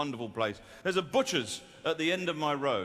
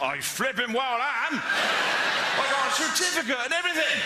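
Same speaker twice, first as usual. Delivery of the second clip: indignant West Country yokel; 10 semitones higher, 5 dB louder than usual. Retold or imitated. Offended yokel insisting he's a real doctor, indignant West Country accent.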